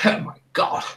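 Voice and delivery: awestruck lord, swooping up high; hushed gasp building to high, swooping delight; Lord Botherington Botherington Smythe. Acted. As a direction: marvel theatrically over a speaker. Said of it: "Lord Botherington Botherington Smythe go".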